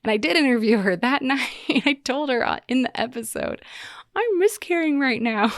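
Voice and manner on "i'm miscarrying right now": mock chipper. Telling therapist mid-miscarriage, high.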